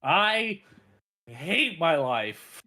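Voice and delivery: loud, drawn-out groan of defeat; mock despair. Real, not acted. Caught lying about Missouri to his friends, mock despairing wail. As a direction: wail it like you've just been caught out.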